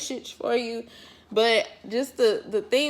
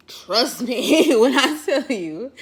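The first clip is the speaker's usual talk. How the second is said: laughing; 3 semitones higher, 5 dB louder, slower than usual. Real, not acted. Laughing emphatic aside.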